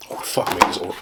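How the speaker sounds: breathy whispered curse under the breath; real whispered frustration; exasperated whisper. Real, not acted. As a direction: mutter it to yourself in disbelief. Oreo is upside down.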